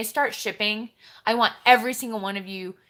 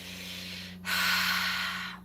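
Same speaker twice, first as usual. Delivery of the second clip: long sigh; 9 dB quieter than usual. Real, not acted. Sigh after the quote.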